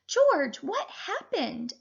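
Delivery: put-on high boy's voice, calling out; a boy surprised by the mess; curious boy. A narrator's reading. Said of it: "asked one boy".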